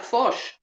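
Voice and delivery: "fosh" with a short open o vowel; demonstrating the Kiwi "fosh" sound. Shown as a demonstration. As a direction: say "fosh" softly, rhyming with posh. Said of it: "It sounds like".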